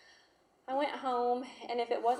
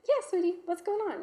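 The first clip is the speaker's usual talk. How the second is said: sweet mom; 9 semitones higher, 5 dB louder than usual. Retold or imitated. Mother answering child sweetly.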